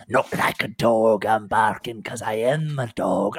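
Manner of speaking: loud, snarling wild woman; snarling dog-woman. Acted. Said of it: "the woman on the ground then snarls and says".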